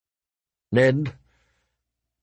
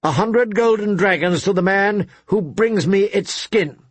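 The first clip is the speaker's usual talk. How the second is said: the Queen lifts her voice higher across the hall; a queen raising her voice, higher; raised voice; 8 semitones higher than usual. A narrator's reading. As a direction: call out the reward, raised and high. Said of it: "The Queen raised her voice".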